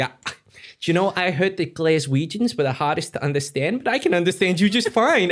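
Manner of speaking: high, imitated American lady; mimicked New York lady, rising in volume; American accent. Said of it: "the woman turned around and just went like".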